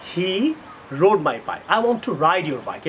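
ordinary talk, nothing marked

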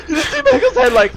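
high, fast talk through laughter; laughing. Real, not acted. Player amused by Mango's dangling head, laughing. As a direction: crack up while describing it.

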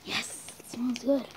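hushed whisper. Real, not acted. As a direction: whisper softly. Whispering about the smell.

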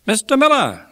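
very high, loud gentlemanly call; Pickwick calling out. A narrator's reading. Pickwick calls to hard-headed old acquaintance, high hearty hail.

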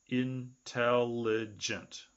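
"Intelligent" drawn out very slowly; slow syllable count. Shown as a demonstration. Teacher to class counting syllables of "Intelligent", very slow. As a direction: give each beat of "Intelligent" its own space. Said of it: "That's four syllables".

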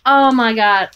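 ordinary talk

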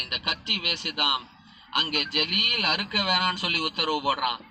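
ordinary talk

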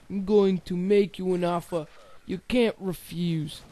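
a Godfather-style offer that drifts Australian; lightly put-on mobster making an offer. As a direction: mobster voice that slips toward an Aussie accent. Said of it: "Mobster voice sounds like I'm an Aussie".

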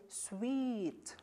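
sweet with a long drawn-out ee; prolonged sweet. Shown as a demonstration. Teacher showing students a long sweet. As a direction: stretch the ee in sweet. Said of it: "Try to extend it, prolong a little bit".

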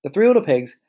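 usual speaking voice

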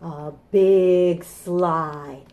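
slow, drawn-out storybook reading; reading to children. Acted. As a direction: read it like a picture book.

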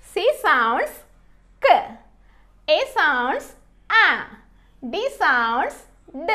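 "K", "A", "D" as short isolated sounds; swooping "sounds", then a short phonic after a pause; phonics sounding-out. Shown as a demonstration. Teacher sounding out C, A, D for learners, each sound short and separate. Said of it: "ఎలా పలుకుతుందో చూద్దాము".

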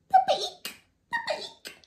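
very high squeaky pip-a-eek; mouse squeak. Acted. Mouse squeaking at the hole's edge.

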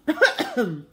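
loud fake coughing; put-on cough. Boy faking illness to the nurse, with a put-on cough. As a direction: cough for show. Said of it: "Henry coughed".

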